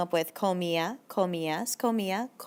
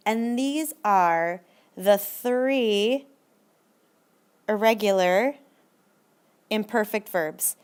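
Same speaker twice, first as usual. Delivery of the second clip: slow dramatic announcement; 4 dB louder, much slower than usual. Acted. Dramatic build-up to irregulars.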